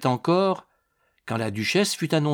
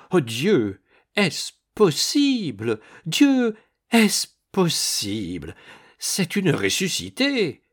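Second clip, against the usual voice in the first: amazed exclamations with big pitch swings, drawn out; astonished, beside herself; 4 semitones higher, slower than usual. A narrator's reading. Duchess amazed before the girl under her mother's portrait, beside herself. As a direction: gasp it out slowly in astonished disbelief. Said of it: "tellement surprise qu'elle semblait hors d'elle".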